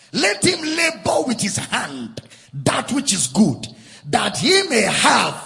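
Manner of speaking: preached shout. Real, not acted. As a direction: hammer the verse home to the congregation. Same verse repeated as preached shout.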